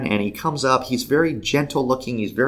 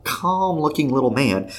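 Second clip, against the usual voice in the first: usual speaking voice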